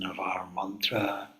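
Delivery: breathy near-whisper after the long silence. Acted. Teacher coming out of silent recitation, whispering softly. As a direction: ease back in without waking anyone.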